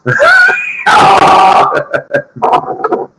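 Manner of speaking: long, high wheezing cackle. Real, not acted. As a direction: cackle at your own joke. Laughs after robots joke.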